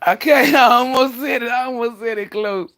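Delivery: high laughing blurt. Real, not acted. Nearly slipping up on stream, high laughing relief. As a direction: laugh it off, caught out. Laughs after near slip.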